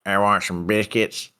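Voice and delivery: slow, drawling country voice; Sling Blade twang. Acted. Co-host plays Sling Blade asking for biscuits, slow twang. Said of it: "You can do the sling blade".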